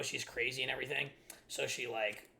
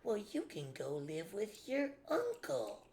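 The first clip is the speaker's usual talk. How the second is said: mocking stepmom voice; 6 semitones higher, slower than usual. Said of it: "she's like".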